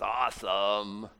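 slowly stretched "awesome"; drawn-out praise. Acted. God giving a pat on the back, drawn-out praise. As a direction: draw out the approval at an easy volume. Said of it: "God says".

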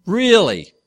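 loud, high exasperated disbelief; incredulous. Real, not acted. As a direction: throw it at the silent class, half teasing. Pastor gets no answer from class.

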